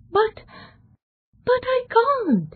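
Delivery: put-on young man realising he cannot undo it, dismayed; anguished cry. A narrator's reading. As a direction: blurt it out in dismay. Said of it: "he cried".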